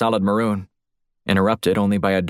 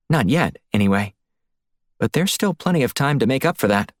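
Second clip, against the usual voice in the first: higher, lively woman's voice; reasoning female colleague; 4 semitones higher than usual. Acted. Woman explaining to her partner why Blunt wasn't told, higher and insistent. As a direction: pitch it up as a female partner making her case.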